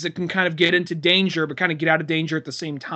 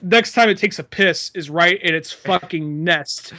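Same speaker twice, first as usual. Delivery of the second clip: ordinary delivery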